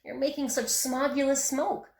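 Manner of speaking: lightly voiced Lorax complaining; grumbling Lorax. A narrator's reading. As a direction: scold him like a fussy little guardian. Lorax scolding in character.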